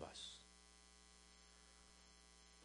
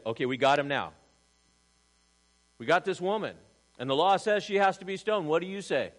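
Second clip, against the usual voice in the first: loud, higher, pushy challenge; mocking accusers; 5 semitones higher, 8 dB louder, faster than usual. Preacher voicing Pharisees who trap Jesus, loud and taunting. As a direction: imitate the Pharisees cornering Jesus. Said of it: "You imagine these guys".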